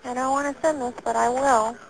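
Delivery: ordinary delivery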